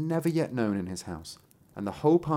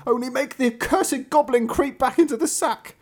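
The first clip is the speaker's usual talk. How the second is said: high, loud begging voice of the beaten host; pleading innkeeper; 5 dB louder than usual. A narrator's reading. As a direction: plead to make it stop, pitched up. Innkeeper begging during the beating.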